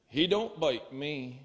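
dry farmer punchline, low and slow; Vermont farmer. Acted.

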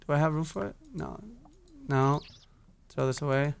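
ordinary delivery

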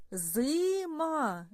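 Зима with a long, held И; second sound stretched. Shown as a demonstration. Teacher helping children hear the next sound, И stretched. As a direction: draw out the И in Зима. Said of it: "Який чуємо звук".

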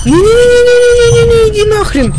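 high, loud panicked no-no-no; panicked screaming. Real, not acted. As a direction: shriek in real fright. Scared by a horror game monster.

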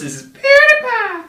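PewDiePie screamed high and loud; high, loud shouted name; catchphrase shout. Acted. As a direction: blast your own name like an intro jingle. Signature intro catchphrase.

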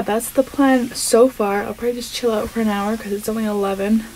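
ordinary delivery

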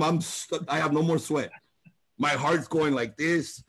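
ordinary talk, nothing marked

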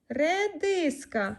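Редиска drawn out slowly, each sound clear; slow clear naming. Shown as a demonstration. Mother prompting daughter with the word, slow and clear. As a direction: stretch every sound of Редиска for the child. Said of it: "Що це є?".

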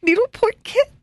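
high, crying wail; wailing in tears. Retold or imitated. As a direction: sob it out like a heartbroken child. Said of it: "I'm in tears".

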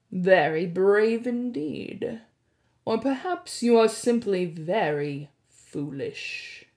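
slow, oily mockery; smarmy blackmailer. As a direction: savor the insult slowly. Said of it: "in an oily voice".

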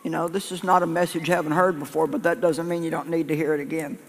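ordinary delivery

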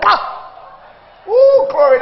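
loud smack, then a high, loud "Ooh, glory"; lip smack and glory. Retold or imitated. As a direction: smack the lips, then a high cry of delight. Savouring sweet milk.

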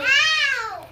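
sharp loud yelp of pain; real ow of pain. Real, not acted. Child's finger caught in the toy, sharp loud yelp. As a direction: yelp as it pinches.

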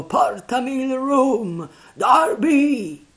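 high, loud, drawn-out call; shriller ghostly cry. A narrator's reading. Unseen voice demanding rum, high wailing cry. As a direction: wail it high and long. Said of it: "fattasi alquanto più acuta".

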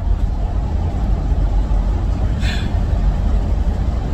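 long weary exhale; a fed-up breath out after ranting; long sigh. Real, not acted. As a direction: let out a drawn-out tired sigh. Exasperated end of rant.

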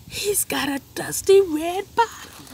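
high, loud gleeful reveal; spring the punchline; reading the funny reveal to children; big reveal. A narrator's reading.

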